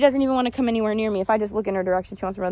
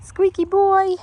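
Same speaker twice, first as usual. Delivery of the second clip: cooing pet name; 11 semitones higher, 3 dB louder, slower than usual. Real, not acted.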